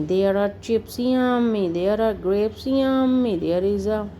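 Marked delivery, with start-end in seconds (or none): 1.0-1.7 delighted yummy. Acted. Reader relishing picnic foods. 2.7-3.4 delighted yummy. Acted. Reader relishing picnic foods.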